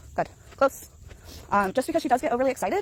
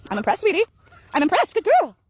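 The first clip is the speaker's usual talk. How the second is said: much higher, louder praise for the dog; 9 semitones higher, 6 dB louder than usual. Real, not acted. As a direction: cheer the dog on, sing-song high. Praising her dog on a walk.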